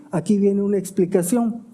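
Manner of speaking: ordinary delivery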